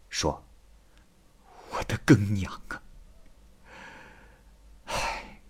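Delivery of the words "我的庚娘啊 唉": softly sighed lament, trailing off; grieving lament. Acted. Husband grieving for his wife Gengniang, soft sighing lament. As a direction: mourn a lost wife under your breath, then sigh.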